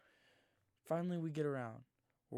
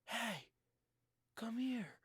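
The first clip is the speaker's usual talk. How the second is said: called out much higher and louder, like a stranger in the dark; stranger calling out; 9 semitones higher, 4 dB louder, much slower than usual. Retold or imitated. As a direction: shout it up high to lure them over. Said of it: "and then he goes".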